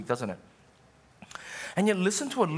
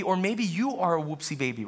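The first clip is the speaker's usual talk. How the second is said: ordinary delivery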